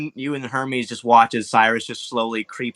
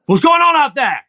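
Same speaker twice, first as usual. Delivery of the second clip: high, loud challenge; guard calling out; 12 semitones higher, 6 dB louder than usual. Acted. Game master as guard who heard clanging armor, shouting out. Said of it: "he's heard you".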